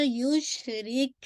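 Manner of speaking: युशरिक slow, spread श and crisp क; careful युशरिक; recitation demo. Shown as a demonstration. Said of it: "शीन पे आपका तफशी है". (युशरिक धीरे, श फैला हुआ)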